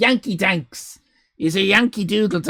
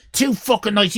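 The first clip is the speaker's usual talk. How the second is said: usual speaking voice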